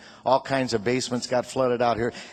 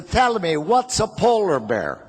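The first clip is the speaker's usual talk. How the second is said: put-on slow, higher Italian-accented question; mock Italian-English, drawn out; Italian father; 4 semitones higher, slower than usual. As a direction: ask like an Italian immigrant dad. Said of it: "He always had problems with the English language".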